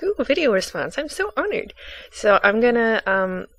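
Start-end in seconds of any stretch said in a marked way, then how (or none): 0.0-1.7 high-pitched, soft gush of delight; honored fangirl squeal, kept small; thrilled. Retold or imitated. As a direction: re-enact a flattered little "ooh", pitched way up. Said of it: "I was really thrilled about".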